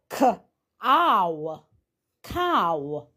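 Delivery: K-ow sounded out, cow blended with a falling ow; sound out then blend. Shown as a demonstration. Teacher shows children the ow sound, sounding out slowly. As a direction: spell K-ow, then drop into cow. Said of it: "we change the sound to ow".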